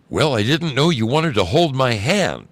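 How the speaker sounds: strange voice. A narrator's reading. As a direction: pipe up as an unexpected stranger. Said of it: "I hear this strange voice say".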